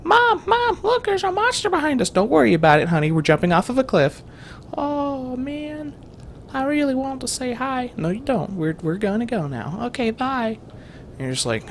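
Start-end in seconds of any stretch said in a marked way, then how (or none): 0.0-2.1 put-on much higher, excited child calling; a kid warning mom about the monster; excited little kid. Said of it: "that's a little kid". 2.1-4.2 breezy mom. Retold or imitated. Mom answers kid in skit. 4.8-7.9 put-on high, whiny child voice; a kid wanting to greet the monster; disappointed kid. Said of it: "that's a little kid". 8.0-10.6 dismissive mom. Retold or imitated. Mom answers kid in skit.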